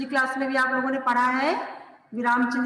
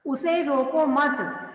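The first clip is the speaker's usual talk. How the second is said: slow, raised "उसे रोको मत", then stop; "उसे रोको मत" as one drawn-out, higher group; comma after मत; 4 semitones higher, much slower than usual. Shown as a demonstration. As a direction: attach "मत" to "रोको" and fall into a pause. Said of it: "देखिए कहाँ पे लगा हुआ है अल्प विराम".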